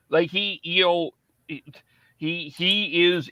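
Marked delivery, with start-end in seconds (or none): none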